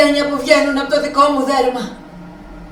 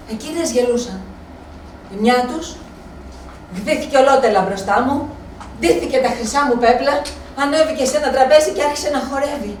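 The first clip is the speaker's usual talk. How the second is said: usual speaking voice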